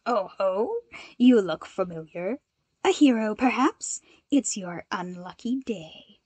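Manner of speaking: mocking villain voice sinking to a whisper; sneering villain. A narrator's reading. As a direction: taunt the hero, ending in a hiss. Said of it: "Extinguisher sneered".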